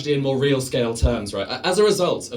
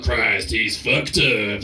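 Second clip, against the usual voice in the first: announcer; 5 semitones lower, much slower than usual. Retold or imitated. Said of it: "the announcer's going like".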